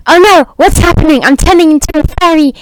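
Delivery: high, loud, hurried panic; mock panic. Human turning into a fairy, hurried mock panic. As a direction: rush it out in acted alarm. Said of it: "it's like".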